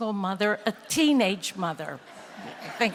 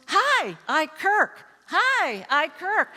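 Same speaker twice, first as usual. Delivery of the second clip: put-on high, eager little-boy greeting; little boy running for office; 7 semitones higher, slower than usual. Son greeting everyone on first school day, eager high voice. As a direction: greet the hallway like a small campaigner. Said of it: "like he was running for office".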